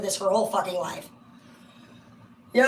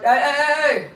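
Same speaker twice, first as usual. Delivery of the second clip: calling out; 6 semitones higher, 8 dB louder than usual. Retold or imitated. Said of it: "You're like".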